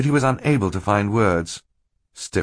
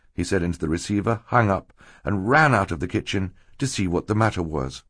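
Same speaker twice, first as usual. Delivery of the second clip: ordinary delivery, nothing marked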